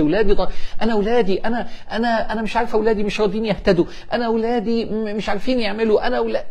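high-pitched fretting mother, stammering repeats; أم قلقانة. Acted. (تقليد أم محتارة بصوت عالي الطبقة)

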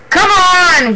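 high, loud, long call; full-voiced battle summons; yelled rallying cry. A narrator's reading. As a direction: yell to urge the cats onward. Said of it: "he yelled".

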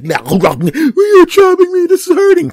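very high, loud wailing cry; crying tire. Pretend. Evil tire crying while chopped, very high loud wail. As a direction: whine like a hurt squeaky toy.

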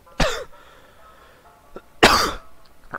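harde hoest. Real, not acted. Character dies, player chokes.

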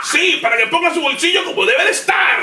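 shouted high hype pitch; lucha libre announcer. Acted. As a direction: pump up the crowd. Hyped intro continues.

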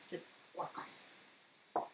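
whispered, slow deadpan joke; whispered aside. Real, not acted.

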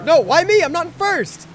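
high, loud indignant protest; outraged yell. Real, not acted. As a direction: wail it out in disbelief.

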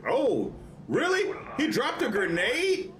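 high-pitched disbelieving outburst; incredulous. Real, not acted. Gamer reacting to an enemy's grenade, incredulous. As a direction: squeal it in disbelief.

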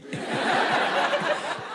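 high breathy mocking cackle; the country laughing at you. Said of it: "hear Nigeria going".

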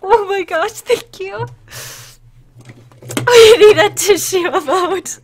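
loud, teary laugh on the words; laughing through tears. Real, not acted. Streamer asks chat for a tissue emote, laughing tearfully. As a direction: joke while really crying.

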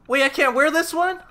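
high, loud incredulous question; can't believe it, voice shooting up; outraged surprise at the game; shocked disbelief. Real, not acted.

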